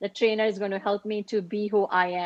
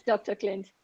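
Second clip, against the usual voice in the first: ordinary talk, nothing marked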